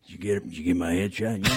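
put-on low Southern old-man voice. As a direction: imitate an old Georgia dad, low. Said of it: "he's like".

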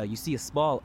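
ordinary talk, nothing marked

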